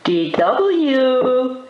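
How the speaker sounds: high, sugary coaxing; sweetest voice. A narrator's reading. Arthur buttering up his sister, high sweet voice. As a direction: sing out the name sweetly and high. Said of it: "he said in his sweetest voice".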